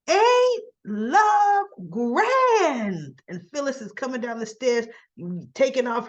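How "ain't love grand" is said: theatrical villain entrance. Acted. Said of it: "all of a sudden you hear this".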